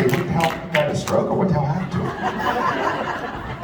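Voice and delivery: slow, hushed voice of his own leg; leg talking. Retold or imitated. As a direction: give the leg a mock voice, half laughing. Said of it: "My leg will say".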